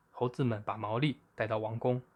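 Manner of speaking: plain reading voice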